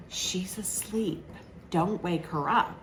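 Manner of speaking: soft, slowed warning, acted out; hushed imitation. Woman in bed telling him not to wake her, hushed.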